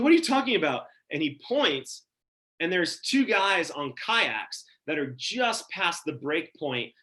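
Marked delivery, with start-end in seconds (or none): 0.0-0.9 high, loud, incredulous question; startled. Retold or imitated. Startled surfer questioning the guy paddling by, acted surprise. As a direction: blurt it out high, acting startled. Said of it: "I'm so startled".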